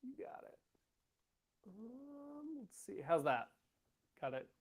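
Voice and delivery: faint voice with the gain down; faint audio. Real, not acted. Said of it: "Not much better".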